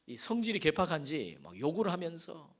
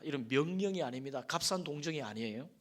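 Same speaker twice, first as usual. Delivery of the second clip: ordinary talk, nothing marked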